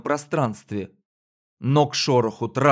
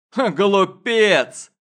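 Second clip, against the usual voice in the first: laughing scorn; 7 semitones higher, 4 dB louder, much slower than usual. Acted. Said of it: "расхохотался мужчина".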